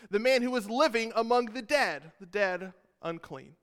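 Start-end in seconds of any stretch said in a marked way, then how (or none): none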